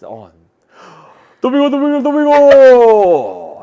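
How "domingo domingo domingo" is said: hammed-up Spanish announcer yell; excited mock Spanish shout. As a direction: bellow it like a Spanish TV host.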